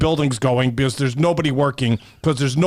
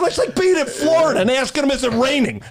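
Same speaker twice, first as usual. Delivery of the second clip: high-pitched sarcastic mockery throughout; sarcastic mock question; 9 semitones higher than usual. Mortgage man mocking the senator with a sarcastic high voice. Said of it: "I go, I go".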